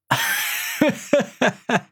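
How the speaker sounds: very high, ringing villain laugh; triumphant laughter. Acted. Six Paths Holy King laughs over the corpse, triumphant laugh. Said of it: "连连大笑".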